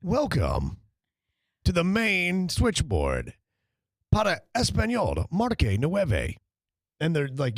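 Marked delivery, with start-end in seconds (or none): 0.0-6.4 slow, polished automated phone menu. Retold or imitated. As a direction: read it like the recorded hold message. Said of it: "you sound like the dude on hold".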